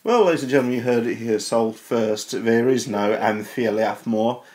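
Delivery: loud, grand announcement to the crowd; announcer voice. Acted. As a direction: proclaim it like a showman. Mock announcement to audience.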